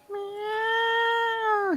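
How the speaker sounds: long, high drawn-out meow; imitated cat meow. Reviewer mimics Catwoman appearing, long high meow. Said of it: "And then we see Catwoman".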